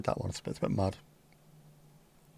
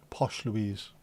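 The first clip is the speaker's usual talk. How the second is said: lower, softer mock-posh "Posh Louise"; 3 semitones lower, 5 dB quieter than usual. Shown as a demonstration. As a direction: say "Posh Louise" like a put-on toff.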